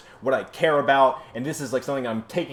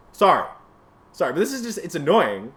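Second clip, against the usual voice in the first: normal talking voice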